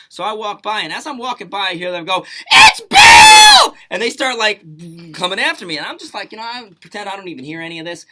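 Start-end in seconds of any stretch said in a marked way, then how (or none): 2.5-3.7 much higher, loud excited shout. Retold or imitated. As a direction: yell it out like you just spotted him. Girls spot him and call out.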